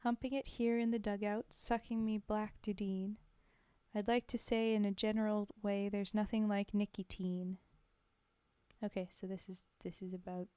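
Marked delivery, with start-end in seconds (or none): 0.0-7.6 rhythmic, steady reading of a soldier's ballad; poem recitation. Acted. Reading a war poem to the stream, rhythmic recitation. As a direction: recite it with the swing of the rhyme.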